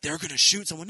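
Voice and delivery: sarcastic alarmist tone; mock the worried parents.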